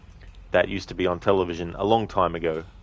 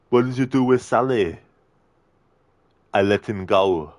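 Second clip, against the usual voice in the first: Arnie impression; 3 dB louder than usual. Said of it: "Arnie movie scene impression".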